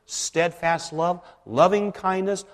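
usual speaking voice

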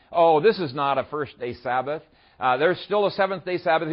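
ordinary talk, nothing marked

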